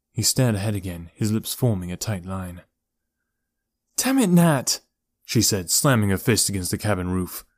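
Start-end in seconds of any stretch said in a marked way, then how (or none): 3.9-4.8 furious. A narrator's reading. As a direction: snap at him in fury. Elora slams fist, furious.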